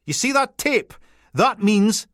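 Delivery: high, loud Peterhead order; grumpy Peterhead PC. Acted. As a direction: jab it at him in broad Peterhead. Grumpy PC lecturing intruder.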